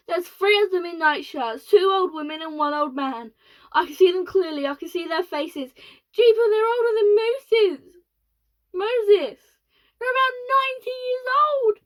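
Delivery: panicked astronaut. Acted. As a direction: shriek it in shock. Panicked astronaut describing old passengers.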